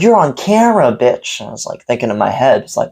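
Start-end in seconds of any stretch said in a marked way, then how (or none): 0.0-1.4 high, hammed-up furious yell; imitated angry yell. Owner yelling at customer filming, imitated high angry yell. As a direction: spit it at him, pitched way up.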